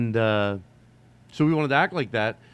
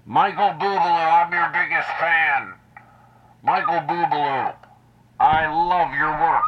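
mock bullhorn call, higher and louder; bullhorn fan; 6 semitones higher, 4 dB louder than usual. Cousin calling through a bullhorn to the singer. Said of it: "he brings a bullhorn".

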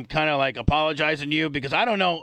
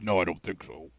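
lower, softer deadpan announcer voice; low announcer impression; 11 semitones lower, 6 dB quieter than usual. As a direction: mimic a low flat announcer. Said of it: "Like Johnny Annoncer there".

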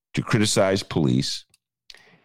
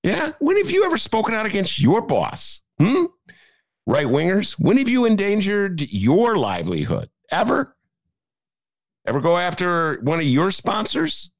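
high, pointed rhetorical jabs; incredulous demands, pitched up; indignant challenge; 9 semitones higher than usual. Real, not acted. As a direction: call them out, voice up high. Challenging right wingers directly.

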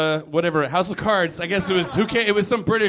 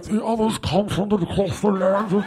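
slow, higher hushed British fantasy voice; Game of Thrones actor; 7 semitones higher than usual. Retold or imitated. Mocking Game of Thrones whisper acting, hushed British. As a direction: mumble grand fantasy lines. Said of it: "they're like".

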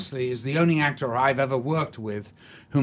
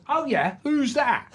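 high, slow, rowdy cockney voice, much higher; cockney drunk; 12 semitones higher than usual. Retold or imitated. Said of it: "He goes".

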